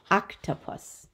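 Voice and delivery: usual speaking voice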